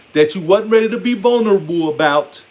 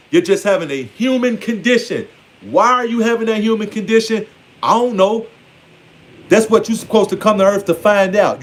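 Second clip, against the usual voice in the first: usual speaking voice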